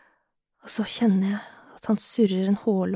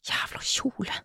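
very soft, whispery pant; panted into the ear; 9 dB quieter, slower than usual. A narrator's reading. Man close at her ear, whispered pant. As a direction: breathe it out under your breath. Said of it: "peser han inn i øret mitt".

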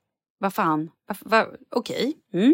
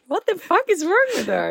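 baffled onlookers; 9 semitones higher than usual. Retold or imitated. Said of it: "De bara kollar på mig".

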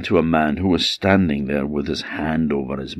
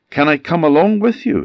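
high character voice; 11 semitones higher, 5 dB louder, faster than usual. Acted. Man asks to join the boat.

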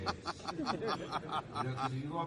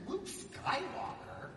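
much higher, slow drawn-out incredulous question; appalled disbelief; much slower than usual. Retold or imitated. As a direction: repeat the name like it's a joke. Told his new name.

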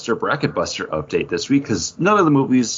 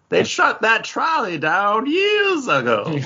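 put-on slow, higher crotchety old-timer; old local man; 9 semitones higher, slower than usual. Old man on porch scolds lost Paul, slow and high.

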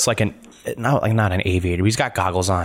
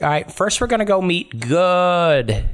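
higher, slow, drawn-out guide; game character leading the way; 10 semitones higher than usual. Acted. Theo to the party as he leads the way, higher guide voice. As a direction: lead them off in a higher voice, slowly.

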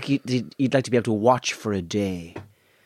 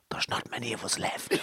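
very soft, near-whispered aside; hushed retold confidence; hushed confiding; 3 semitones lower, 12 dB quieter than usual. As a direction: say it low and secret, right up to someone's face. Retold confidential remark up close.

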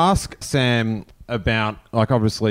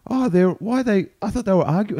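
surprised; 11 semitones higher than usual. Retold or imitated. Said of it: "I was like".

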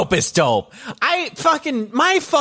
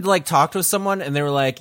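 normal talking voice

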